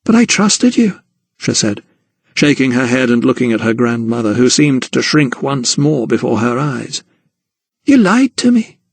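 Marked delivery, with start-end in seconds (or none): 0.1-1.0 much higher hurt voice; a betrayed girl; Emily to gran, higher and hurt. Acted. 7.8-8.8 much higher, louder accusation; a betrayed girl; Emily to gran, high and wounded; hurt accusation. Acted.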